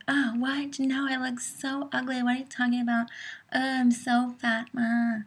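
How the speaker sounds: high, put-on whiny self-pity; mock protest in a high voice; feigned obliviousness. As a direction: play coy while fishing for compliments. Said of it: "that's my impression of how that goes".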